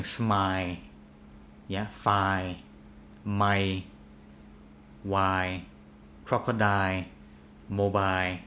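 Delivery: smile, file as 'smy, fi', endings cut; dropped final L. Retold or imitated. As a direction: crocodile and mobile ending on a bare 'eye'. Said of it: "I hear people saying".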